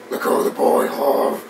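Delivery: hoarse, voiceless growl; mock a raspy superhero voice; strained throaty whisper-growl; Batman. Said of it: "why are you talking like that?".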